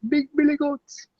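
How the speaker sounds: "Big Billy Goats" sung out high in a hammed-up Jamaican accent. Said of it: "I always say it with, like, a Jamaican accent".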